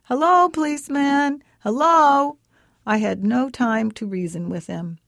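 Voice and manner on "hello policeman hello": put-on child's eager call, louder and higher; little boy calling out to the policeman, loud and high. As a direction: shout hello across the yard to the policeman. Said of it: "He began to call out".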